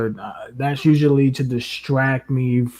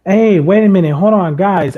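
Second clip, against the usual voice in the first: loud, higher, eager; excited interruption; 7 semitones higher, 9 dB louder than usual. Real, not acted. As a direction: cut in with sudden excitement. Suddenly interrupts with a find.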